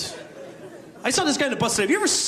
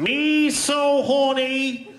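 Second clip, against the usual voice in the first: slow, much higher mock accent; JFK as Saigon prostitute; 12 semitones higher, much slower than usual. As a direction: drag it out in a hammed-up accent. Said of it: "Kennedy is a prostitute in Saigon".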